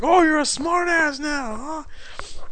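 high, slow, sneering imitation; angry caller. Host mimics Pasquale taunting him, high and slow. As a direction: drag out the taunt in a high mock voice. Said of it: "He's like".

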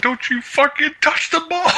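high mock yell at the TV; retold yell. Yelling at the TV during the game, high mock yell. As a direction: shriek the order up high. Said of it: "I was like".

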